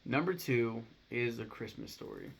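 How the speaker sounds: ordinary talk, nothing marked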